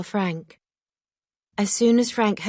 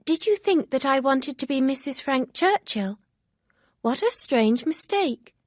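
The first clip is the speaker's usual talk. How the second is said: higher, lightly voiced young girl; a hint of an eager young friend; Harriet, lightly voiced, eager; 7 semitones higher, 4 dB louder than usual. A narrator's reading. Harriet speaks of her love.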